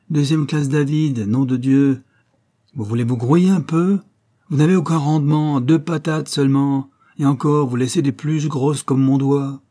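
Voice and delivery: loud, high barking sergeant voice yelling at a recruit; mock drill sergeant. Louis mimics his army sergeant to make Odette laugh, a loud, high, hammed-up bark. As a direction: bawl it out like a sergeant on kitchen duty, half joking.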